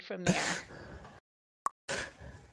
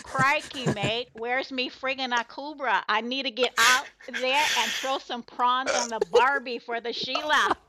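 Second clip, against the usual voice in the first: hammed-up mock Australian accent, pitched higher; Aussie accent; 4 semitones higher than usual. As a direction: put on a playful Aussie accent, high and lively. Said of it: "in an Aussie accent".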